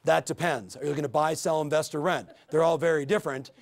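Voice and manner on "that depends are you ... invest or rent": matter-of-fact reply. Shown as a demonstration. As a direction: run through the options without any lift. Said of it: "That's different from".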